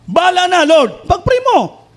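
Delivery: high, loud pleading call to the Lord; fervent prayer cry. Retold or imitated. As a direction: cry out the prayer with total surrender. Acting out his own prayer. (masidhing panalangin sa Panginoon)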